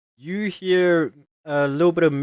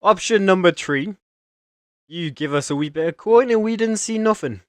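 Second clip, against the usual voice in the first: higher, louder sly offer; bribing guard; 6 semitones higher, 3 dB louder than usual. Acted. City guard hints at a bribe, sly guard voice. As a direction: fish for a little coin.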